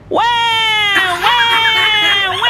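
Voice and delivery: put-on loud, high baby "wah" cries; piercing storyteller's imitation of a baby; baby wailing. A narrator's reading. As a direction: bawl like a baby, long and loud. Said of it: "The baby looked at the teacher and said".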